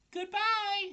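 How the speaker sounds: very high, loud little farewell call; squeaky spider calling back as it floats off; tiny voice calling goodbye. A narrator's reading. Spider calls as it sails.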